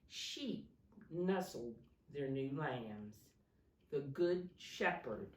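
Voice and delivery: put-on dramatic read-aloud for kids; storybook reading. A narrator's reading. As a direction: read the Christmas story to little ones with energy. Reading picture book to children.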